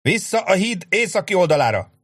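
very high, urgent command; urgent retreat shout. A narrator's reading. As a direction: hurry the men back. Sharp ordering retreat.

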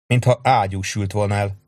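ordinary delivery, nothing marked

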